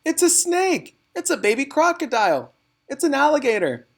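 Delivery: high, loud panicked shrieks; children shriek at the newt in alarm; children shouting. A narrator's reading. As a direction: shriek like scared kids seeing a monster.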